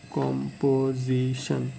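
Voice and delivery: Composition slowly in four beats, কম, পো, জি, শন; syllable by syllable. Shown as a demonstration. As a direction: sound out composition piece by piece. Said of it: "আমরা চারবারে যে ভাঙাইতেছি". (Composition চার ভাগে থেমে থেমে বলা)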